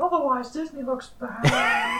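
high, squeaky cartoon shout; Mickey Mouse voice. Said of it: "do a whole episode in that voice".

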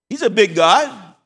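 slow, loud proclamation; booming declaration; emphatic. Real, not acted. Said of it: "emphatic preaching line".

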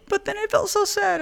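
high laughing. Real, not acted. Mock sad after joke.

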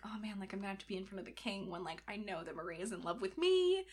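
quiet, sheepish aside; awkward. Retold or imitated. Cardinal about to face the king, awkward and hushed. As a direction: imitate a man dreading an awkward moment, voice dropped. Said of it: "feeling a little awkward".

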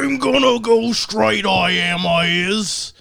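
slow, high Cockney gangster impression; Cockney accent. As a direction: drawl it like a London hard man. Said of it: "Is he in full Cockney accent?".

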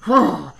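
loud huffed mock grunt; short exaggerated huff. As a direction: imitate a heavy man grunting. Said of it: "So he starts grunting".

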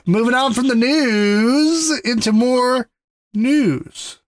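high, slow announcer delivery; drawn-out showy segue. Shown as a demonstration. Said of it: "You like how I did that there".

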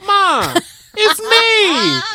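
high, loud plea; daughter calling out. Retold or imitated. The daughter on the porch shouting to her angry mom, high and loud. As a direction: call out high and loud so mom knows it's you. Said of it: "And she's like".

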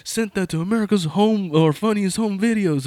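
high-pitched mocking jeer; imitated teasing. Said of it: "he was, like, teasing".

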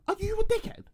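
high-pitched disbelieving question; mock incredulity. To investigators ignoring all the evidence, mock incredulous. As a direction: squeak it out in disbelief. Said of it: "it's like".

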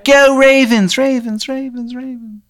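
loud high cheer that echoes away; high disembodied voice, fading echo; echoing voice in the head. Acted. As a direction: call it out, then fade each repeat like an echo. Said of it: "you hear this voice in your head".

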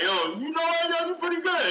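high mock-impressed voice. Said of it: "I wasn't even like".

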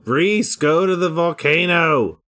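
loud, high call across the way; yelling. Acted. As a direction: shout directions to Reese. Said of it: "I'll just yell".

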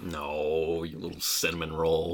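soft, low and tender. Real, not acted. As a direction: coo it softly. Tender aside to Machi.

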